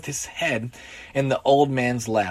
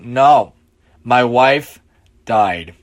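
low, heavy, halting confession; weighty admission of loss; grieving drunk; 5 semitones lower, 6 dB louder, much slower than usual. A narrator's reading. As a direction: say it low and loud, breaking it with pauses. Drunk reveals his wife died.

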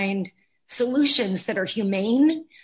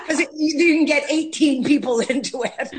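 words broken by laughter; laughing; 5 semitones higher, 4 dB louder than usual. Real, not acted. Actress laughing about the big car she bought. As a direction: laugh while telling it.

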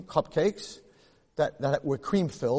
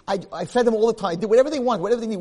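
indignant rant; 7 semitones higher, 7 dB louder, faster than usual. Retold or imitated. Listing all he did for kids.

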